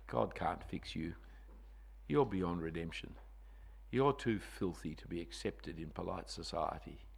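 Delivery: low, soft sneering whisper of the evil one; the devil's lie. Retold or imitated. Preacher voicing the evil one's lies to the church. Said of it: "his lie is".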